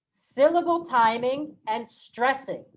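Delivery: ordinary talk, nothing marked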